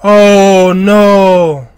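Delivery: usual speaking voice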